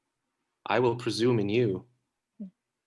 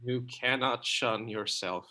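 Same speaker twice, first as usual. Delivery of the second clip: tender. Acted. Troilus soothes Cressida.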